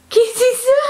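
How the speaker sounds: high, loud delighted cry; excited squeal. Real, not acted.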